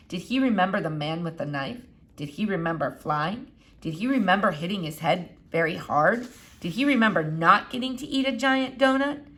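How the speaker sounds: ordinary delivery, nothing marked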